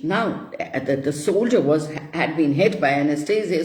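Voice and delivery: ordinary delivery, nothing marked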